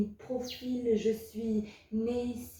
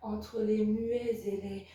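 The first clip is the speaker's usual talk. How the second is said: softer, hushed closing verse; subdued poem ending; 2 semitones lower, 5 dB quieter than usual. Acted. Child of alcoholics closing a poem on exile, subdued recital. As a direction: let the recital sink away, slowing on the last words.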